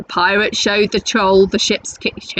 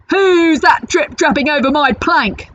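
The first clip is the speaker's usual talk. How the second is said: jeering; 7 semitones higher than usual. Acted. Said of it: "jeered Hank Chief".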